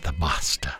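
low, soft whisper; hushed reverence. Pretend. Reviewer echoing praise of the storyteller, hushed whisper. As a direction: whisper it as an awed aside.

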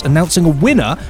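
usual speaking voice

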